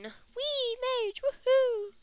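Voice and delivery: high-pitched singsong cheer; playful whooping. Pretend. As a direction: whoop gleefully, stretched out and high. Cheering a mage at start.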